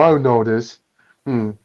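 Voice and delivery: usual speaking voice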